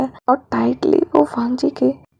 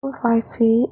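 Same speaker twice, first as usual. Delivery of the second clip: plain reading voice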